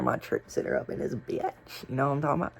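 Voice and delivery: ordinary talk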